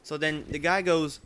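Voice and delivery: normal talking voice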